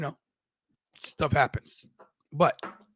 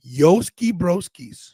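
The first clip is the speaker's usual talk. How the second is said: loud slow bro greeting; 4 semitones higher, 7 dB louder, much slower than usual. Acted. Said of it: "repeated greeting".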